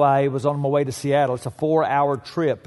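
usual speaking voice

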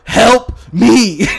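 high, loud yelled plea for help; mock cry for help. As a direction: yell it like you're drowning. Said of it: "this is a cry for help".

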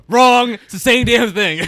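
loud playful shout of Wrong; loud laughing verdict. Real, not acted. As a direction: gleefully bellow the verdict. Game show style verdict.

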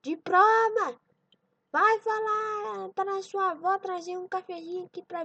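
high, loud call to a grandson; acted farmer calling out, high-pitched. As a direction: holler for the boy across the house. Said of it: "ele chama".

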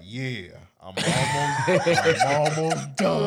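slow, sing-song and sneaky; sly drawn-out answer. Retold or imitated. Little brother answering from the fish tank, slow and sneaky. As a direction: stretch it out like you're hiding something. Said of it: "Why did he say it like that?".

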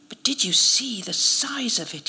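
weak, whispery voice; faint, airy protest from an injured man; weakly. A narrator's reading. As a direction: say it feebly, mostly breath. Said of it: "he said weakly".

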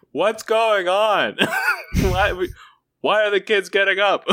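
distant high-pitched mother; high mom calling out. Acted. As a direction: call out like a fussy mom. Mom calling from far end.

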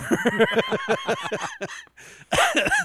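very high-pitched cackling laugh. Real, not acted. Podcaster laughing at the missing season four, high cackle.

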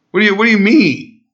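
high, fast, incredulous retort; mock outraged what-do-you-mean; offended disbelief. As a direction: blurt it out like he's offended. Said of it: "he literally was offended".

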